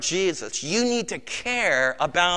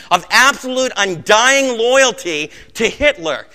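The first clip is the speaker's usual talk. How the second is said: building intensity; 3 semitones higher, 7 dB louder, slower than usual. Acted. Preacher builds toward climax.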